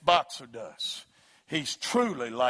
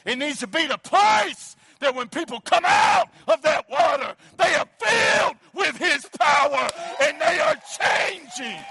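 full-force preaching; 12 semitones higher, 7 dB louder than usual. Real, not acted. Preacher shouting to the congregation about Bethesda's power.

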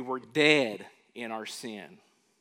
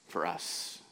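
whisper; 8 semitones lower, 7 dB quieter than usual. Acted. Preacher answers his own question.

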